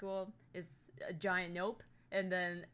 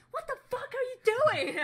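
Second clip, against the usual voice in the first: high, loud outraged yell; angry tough love; 11 dB louder than usual. Acted. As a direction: blast your friend for doing something stupid. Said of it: "I would be so angry".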